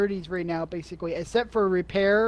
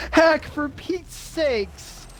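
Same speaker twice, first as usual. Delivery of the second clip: mock exasperated outburst; 6 semitones higher, much slower than usual.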